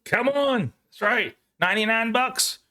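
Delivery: high, lightly hammed Texas accent; a light put-on Texas twang. Joking with a friend in a light Texas accent. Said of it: "Trying to get my Texas accent on".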